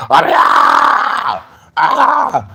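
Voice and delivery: breathy then high mock scream of a meltdown. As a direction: let out a hammed-up meltdown scream. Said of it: "melting down or screaming".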